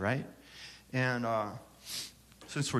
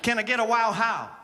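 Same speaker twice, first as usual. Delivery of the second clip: preacher call-out; 9 semitones higher, 4 dB louder than usual. Pretend. Call and response with crowd.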